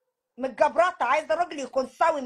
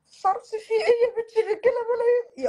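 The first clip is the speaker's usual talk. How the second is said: higher, louder laughing-crying wail; crying scold; 7 semitones higher, 3 dB louder than usual. Acted. Mother crying to her daughter that there are plenty of men.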